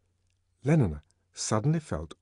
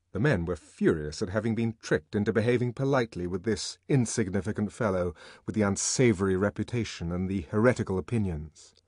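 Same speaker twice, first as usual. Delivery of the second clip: ordinary delivery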